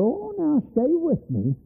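high, slow pleading; pleading woman. Retold or imitated. Sinner at altar pleading, high slow. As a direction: beg someone to stay. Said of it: "It was a bad thing here to go".

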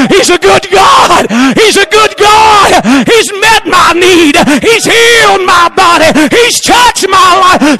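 high, loud shouted preaching, slow; fiery revival preacher shouting praise; preaching at full force. Acted.

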